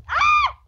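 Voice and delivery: very high, loud shriek; startled shriek. Real, not acted. Player attacked mid-game, startled shriek.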